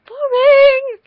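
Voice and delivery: "Boring!" yelled high and loud; mock shout. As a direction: belt out "Boring!" far above your normal voice. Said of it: "'cause that's boring".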